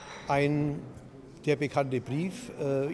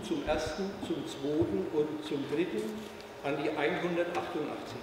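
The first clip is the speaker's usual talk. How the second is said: auctioneer. Real, not acted. Auction calling out the bids.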